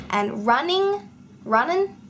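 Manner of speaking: "running" said as "runnin", with the final g dropped and a lift at the end. Shown as a demonstration. A vlogger showing viewers how Aussies drop word ends, rising. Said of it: "we also drop the ends on words".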